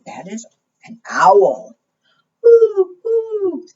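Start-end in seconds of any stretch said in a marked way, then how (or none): 2.4-3.7 very high, drawn-out hoots; owl hoot. Acted. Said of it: "asked the owl".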